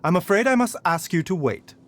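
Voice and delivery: usual speaking voice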